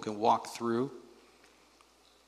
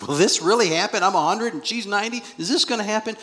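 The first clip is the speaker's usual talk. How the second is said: high, loud amazed questioning; wonder; 10 semitones higher, 6 dB louder than usual. Acted. Preacher voices Abraham hearing of a child, high-pitched wonder. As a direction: voice lifted in astonished wonder. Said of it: "like, just wonder".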